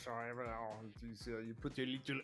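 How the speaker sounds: French character voice. Shown as a demonstration. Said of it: "French character, Olivier".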